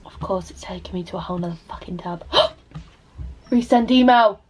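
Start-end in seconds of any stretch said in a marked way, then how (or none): 0.0-2.2 muttered whisper to herself. Real, not acted. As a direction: whisper it under your breath. Whispering to self.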